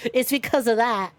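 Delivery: ordinary talk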